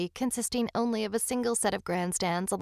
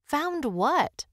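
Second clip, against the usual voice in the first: higher-pitched, puzzled query; baffled, pitched-up question; 8 semitones higher, 4 dB louder than usual. Acted. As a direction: ask it in confusion. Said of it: "she asked".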